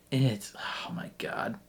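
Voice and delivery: soft, slow unvoiced oh my God; whispered exasperation. Real, not acted. Viewer drained by the episode, whispering in exasperation. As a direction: breathe it out, spent.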